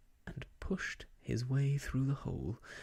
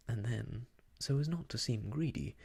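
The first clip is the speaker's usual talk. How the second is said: usual speaking voice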